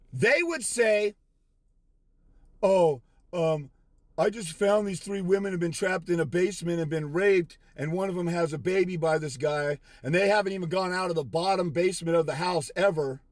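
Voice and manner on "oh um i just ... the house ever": lightly voiced hesitant sissy, lower pitch; mock timid rescuer. Imagined rescuer reporting the trapped women, mock timid. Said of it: "punk-ass sissy like you".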